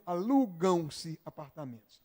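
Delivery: alugam-se apartamentos, lento e claro; devagar, didático. Shown as a demonstration. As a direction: leia devagar como exemplo de aula. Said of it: "Se é mais de um". (exemplo lento de aula)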